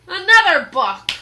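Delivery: loud, high disgusted roar. Acted. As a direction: bellow in disgust. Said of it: "roared Captain Bones".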